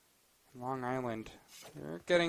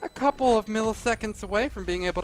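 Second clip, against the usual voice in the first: rising hype, fast play-by-play; excited call; 8 semitones higher, 8 dB louder than usual. Real, not acted. Caster hyped as round swings.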